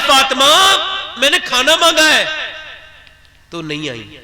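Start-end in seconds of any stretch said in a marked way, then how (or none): 0.1-2.2 louder, higher raised call; calling out again. Retold or imitated. Ali calling again for the food he asked for, louder. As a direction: call her name, then insist.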